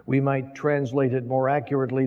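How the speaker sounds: usual speaking voice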